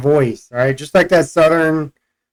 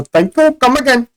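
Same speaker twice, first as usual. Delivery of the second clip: put-on higher-pitched caricatured Indian accent; mock Indian accent; 10 semitones higher than usual. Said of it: "Indian accent".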